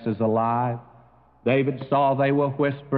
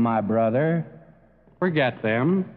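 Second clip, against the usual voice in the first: ordinary talk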